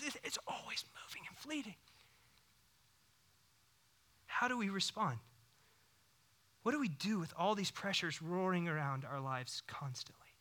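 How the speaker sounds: soft whispered sermon lines; hushed whisper. Acted. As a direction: drop to a whisper to draw them in.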